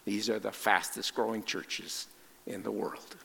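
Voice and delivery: usual speaking voice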